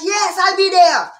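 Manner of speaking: high, loud, drawn-out mock enthusiasm; eager shouted acceptance. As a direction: shout back a slow, eager yes. Acts her eager reply to neighbors.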